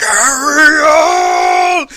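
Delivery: high drawn-out yell of ariel; mock angry shout. Angry father calling for his daughter, mock shout. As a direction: bellow her name like a furious dad. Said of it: "Trenton's all like".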